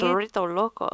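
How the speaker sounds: Dorito Loco in a playful Spanish accent. Shown as a demonstration. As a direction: roll it out with a mock Spanish accent. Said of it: "You're gonna try and say it in a Spanish accent".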